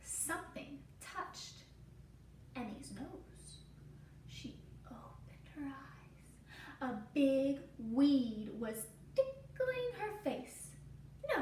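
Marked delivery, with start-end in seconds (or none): 0.0-6.9 slow whisper; hushed narration. A narrator's reading. Storyteller whispering as Emmy wakes. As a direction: whisper it to build suspense.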